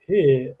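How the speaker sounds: "here" with no r, an open "heh"; mimicked British pronunciation. Baffled by the other man's word, "here" mimicked without its r. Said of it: "and you say, like".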